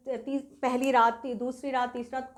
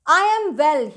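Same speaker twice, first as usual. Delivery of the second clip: much louder, bright-spirited declaration; joyous; 3 semitones higher, 11 dB louder, much slower than usual. A narrator's reading. Recovering young man greeting his host, joyous. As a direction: announce it with joy. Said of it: "he said joyously".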